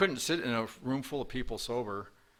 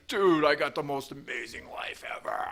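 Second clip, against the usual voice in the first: loud, raised-pitch Boston accent impression; 4 semitones higher, 6 dB louder than usual. Friend texting on the drive home, exuberant Boston accent. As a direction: belt it out in an imitated Boston accent. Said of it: "in this Boston accent".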